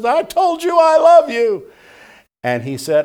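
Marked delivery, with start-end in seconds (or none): 0.0-1.6 high, loud tearful wail imitating a wife; hammed-up sobbing protest; mock crying wail. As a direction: imitate a wife wailing through tears, pitched way up. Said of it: "she's crying and she says".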